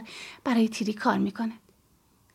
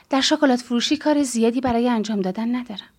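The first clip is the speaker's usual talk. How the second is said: plain reading voice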